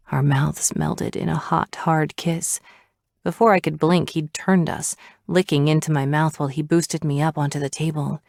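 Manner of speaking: ordinary delivery, nothing marked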